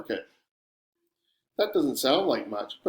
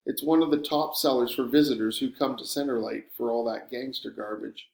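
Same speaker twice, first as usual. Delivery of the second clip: usual speaking voice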